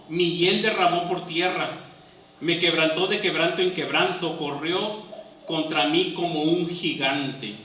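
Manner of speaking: dramatic Bible reading, building in force; impassioned scripture reading. Acted. Preacher reading Job's lament to the congregation, impassioned. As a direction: read Job's lament as if it were his own pain.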